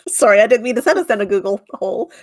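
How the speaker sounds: ordinary talk, nothing marked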